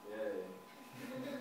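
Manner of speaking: low, soft, half-hearted yay; deadpan mock celebration; unenthused cheer. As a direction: cheer with no enthusiasm at all. Unenthusiastic reply to assignment.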